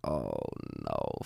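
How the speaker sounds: low, slow, half-asleep groan; groggy groan. Retold or imitated. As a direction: drag it out like you've just been woken. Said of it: "And I'm like".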